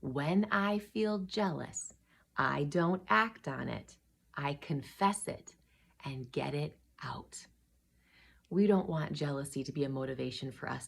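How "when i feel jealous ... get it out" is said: slow, phrase by phrase for others to join; deliberate, softer, with gaps between phrases; say-along recital. Shown as a demonstration. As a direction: lead children in repeating a motto. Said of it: "say that with me".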